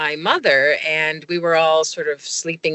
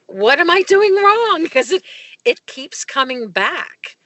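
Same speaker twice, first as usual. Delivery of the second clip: exasperated plea; 10 semitones higher than usual. Retold or imitated. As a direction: act out pleading at wit's end. Retold plea to Ed for help.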